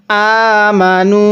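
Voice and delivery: আমানু with the মা held one beat; one-alif stretch. Shown as a demonstration. Teacher shows the one-alif stretch in আমানু. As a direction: draw out আমানু slowly. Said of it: "এক আলিফ টান দিব".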